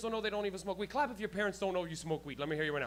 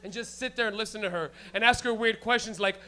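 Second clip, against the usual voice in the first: ordinary talk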